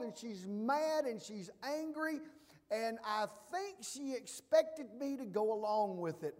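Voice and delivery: loud, rising preacher's cadence; fiery sermon delivery; preaching at full force. Acted. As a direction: build the fire, pulpit-strong. Building preaching about angry woman.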